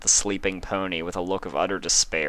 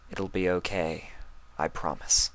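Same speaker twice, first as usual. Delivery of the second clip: softer, almost whispered reassurance; gentle promise; 4 dB quieter than usual. Acted. Luna rocking Spike, whispering a gentle promise. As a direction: soothe him as you rock him. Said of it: "she said, gently rocking".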